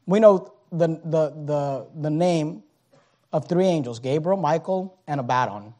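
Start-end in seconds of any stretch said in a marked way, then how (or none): none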